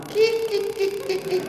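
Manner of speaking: very high squeaky staccato cries; spooky teasing shriek. Retold or imitated. Convert retells scaring neighbours at Quran reading, high spooky squeaks. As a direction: imitate spooky little squeals to scare them. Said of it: "saya teriak dari belakang dapur. Saya bikin takut".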